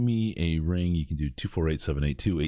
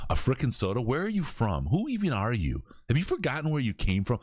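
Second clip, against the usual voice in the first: grilling his brother; 9 semitones higher than usual. Retold or imitated. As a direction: retell a brotherly ribbing in indignant questions. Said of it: "straight as hell, right? All on him".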